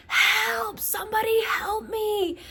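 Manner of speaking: high-pitched cry for help that breaks into a whisper; retold scream for help. Running down the street begging for help, high retold scream.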